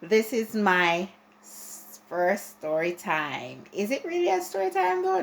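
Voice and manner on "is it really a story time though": much higher, fast, teasing doubt; doubtful self-question. Real, not acted. Vlogger doubting her own label, quick and much higher. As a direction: question yourself quickly, half laughing at the label.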